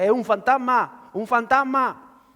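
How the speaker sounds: high, loud and slow, terrified disciples; frightened cry. A narrator's reading. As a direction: cry it out in dread at the ghost. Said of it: "de miedo se pusieron a gritar". (los discípulos gritan de miedo al ver un fantasma)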